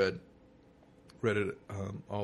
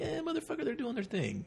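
high-pitched approving fan reaction; mock approving fan. Voicing an approving fan's reaction.

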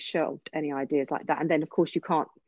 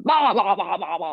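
mock declaiming that tails off; hammed-up Shakespearean bluster; mock yelling. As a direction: parody an actor yelling verse. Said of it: "you're just being yelled at by somebody".

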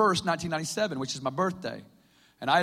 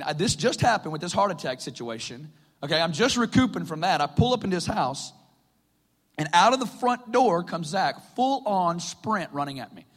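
usual speaking voice

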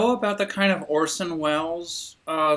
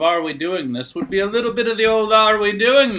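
put-on Cockney accent; 4 semitones higher, 4 dB louder than usual. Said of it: "your accent, quote unquote, is just sliding".

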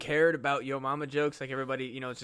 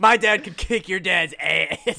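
mock schoolkid boast; 3 semitones higher, 9 dB louder, slower than usual. Grade-school dad threat.

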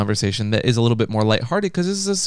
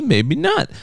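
playful high maybe not; 13 semitones higher than usual. Acted.

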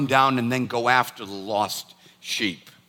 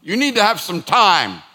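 higher, firm mock-parental scolding; stern scolding; 5 semitones higher, 4 dB louder than usual. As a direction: scold her like a naughty child. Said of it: "I'm like".

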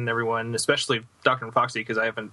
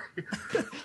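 coughing; 9 dB quieter than usual. Real, not acted. Guest choking on water.

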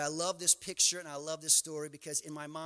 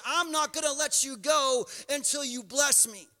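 stubborn cry; 6 semitones higher, 5 dB louder than usual. Acted. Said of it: "Jacob says".

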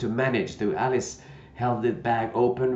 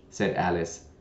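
plain reading voice

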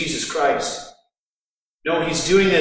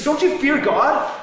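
higher, slow indignant rebuke; rebuking; 8 semitones higher, 3 dB louder than usual. Retold or imitated. Criminal rebuking the mocker across Jesus, indignant and high. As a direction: scold the other criminal sharply. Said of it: "And rebukes him".

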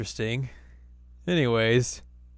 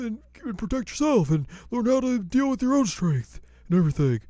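put-on higher, louder old-man lecturing voice; old smith lecturing; 12 semitones higher, 5 dB louder than usual. Totosai explaining the sword to Inuyasha, high fussy lecture.

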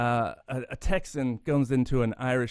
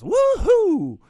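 much higher, much louder whooping cheer; Texan whoop; 11 dB louder than usual. Acted. Texan in Irish pub cheering his bet, loud whoop. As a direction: whoop like a cocky cowboy sure he's won. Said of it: "And the Texan goes".

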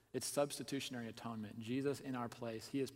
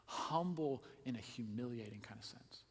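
usual speaking voice

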